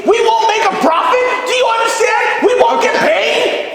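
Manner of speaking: high, loud panicked yelling; high panicked shouting about the ratings. Acted. Panicked objection to the script.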